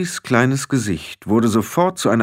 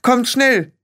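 alarmed high-pitched calling out; loud shrieked summons; Ginny; 4 dB louder than usual. A narrator's reading. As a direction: call urgently for help, very high. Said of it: "rief sie".

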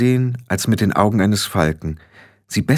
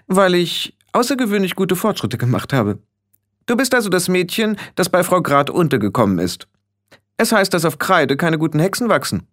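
put-on high-pitched, self-important girl; condescending and superior; haughty young witch leader; 8 semitones higher than usual. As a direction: look down on the newcomer, voice pitched high.

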